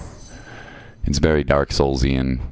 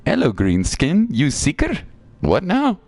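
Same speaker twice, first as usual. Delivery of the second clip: higher, drawn-out creature greeting; little creature; 11 semitones higher, slower than usual. Acted. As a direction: quizzical critter, pitched up. Voicing game creature's dialogue.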